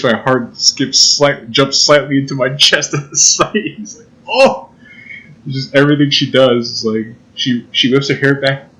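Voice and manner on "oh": high, sudden mock swoon; hammed-up gasp of delight. Lovestruck at her grin, mock swooning.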